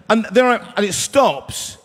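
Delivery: usual speaking voice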